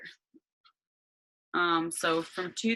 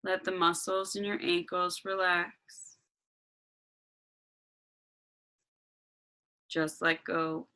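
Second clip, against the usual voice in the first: guided meditation. Acted.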